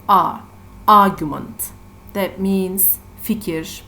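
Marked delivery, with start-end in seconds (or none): 0.1-1.7 "a" alone and loud, then "Argument" hit on its "A"; stress on first syllable. Shown as a demonstration. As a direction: punch the opening "A" of "Argument". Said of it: "the stress syllable is the first syllable".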